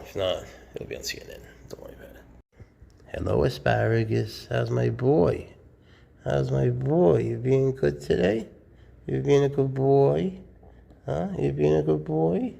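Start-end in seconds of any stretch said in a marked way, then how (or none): 0.0-2.2 whispered aside, unvoiced. Real, not acted. 4.5-12.5 lightly higher cooing to a pet snake. Real, not acted.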